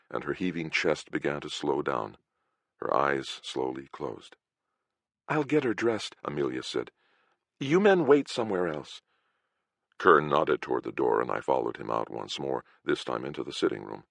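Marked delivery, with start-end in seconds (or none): 5.3-9.0 higher, lightly voiced girl; a hint of a brisk girl; young woman voice. Amelia voiced by narrator.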